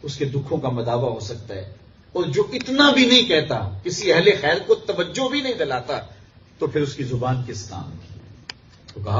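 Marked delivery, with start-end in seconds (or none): 2.1-8.2 high-pitched preaching build; impassioned reproach. Real, not acted. Preacher reproaching those who won't speak for the poor, raised and impassioned. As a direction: lift the pitch in indignant sermon style.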